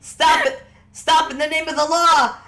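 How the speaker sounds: loud, high shout of command; high-pitched, full-voiced guard's order; guard's yell. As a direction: yell it after a fleeing suspect. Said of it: "Jenka once more yells".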